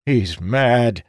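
usual speaking voice

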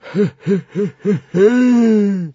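high, echoing sinister titter; snigger, then stretch the last note high; smirking laugh. A narrator's reading. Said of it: "Smirked the library".

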